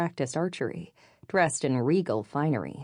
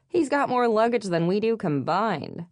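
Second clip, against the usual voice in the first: higher, incredulous scoff; snorted scoff; 6 semitones higher, 3 dB louder than usual. A narrator's reading. Lainey gawks at the man's luggage, mild snorting scoff. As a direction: scoff at the sight, pitched up. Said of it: "she snorted".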